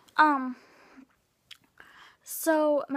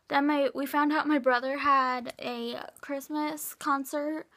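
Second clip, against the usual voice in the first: ordinary talk, nothing marked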